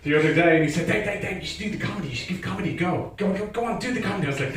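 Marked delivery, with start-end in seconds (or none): 0.9-4.3 higher, slightly softer mock nagging; pestering friend. Friend urging him to try comedy, pestering. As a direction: imitate an insistent friend egging someone on.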